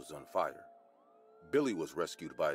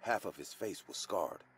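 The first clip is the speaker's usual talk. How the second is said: ordinary delivery, nothing marked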